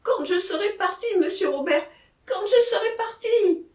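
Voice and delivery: high, pleading outburst; frightened man crying out; panicked cry. A narrator's reading. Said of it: "s'écria Jacquemin".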